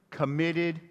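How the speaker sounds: ordinary talk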